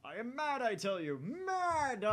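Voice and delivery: loud, high manic ranting; mad scientist. Acted. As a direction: rant like a crazed genius with a laugh. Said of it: "That sounds like a mad scientist".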